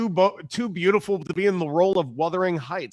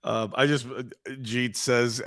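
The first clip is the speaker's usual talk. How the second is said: normal talking voice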